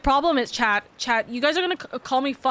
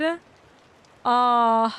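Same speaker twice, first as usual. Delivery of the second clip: ordinary talk, nothing marked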